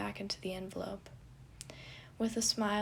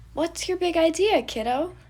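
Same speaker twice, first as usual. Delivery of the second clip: eager; 9 semitones higher, 11 dB louder than usual. A narrator's reading. Fond teasing, high and loud.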